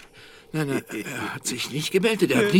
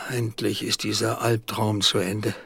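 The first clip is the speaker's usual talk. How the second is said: ordinary talk, nothing marked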